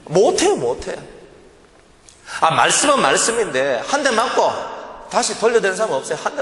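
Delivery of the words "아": usual speaking voice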